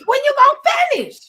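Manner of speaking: loud, fast, exasperated; exasperated outburst. Retold or imitated. Retelling a phone fight with a long-winded friend, loud exasperated outburst. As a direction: blurt it out, fed up and rushing.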